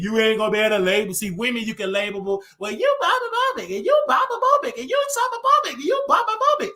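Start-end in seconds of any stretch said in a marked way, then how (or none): 2.6-6.7 much higher, sing-song mock of a woman scolding; mocking falsetto. Mocking the women who label him, in a high squeaky voice. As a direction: squeal the labels like a parody of an accuser.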